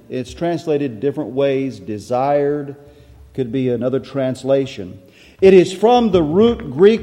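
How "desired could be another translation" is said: ordinary talk, nothing marked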